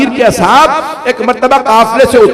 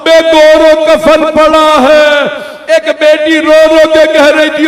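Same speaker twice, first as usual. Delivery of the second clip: mournful majlis recitation; 6 semitones higher than usual. Acted. Preacher to mourners recounting Karbala, impassioned lament. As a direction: wail the tragedy out to the gathering.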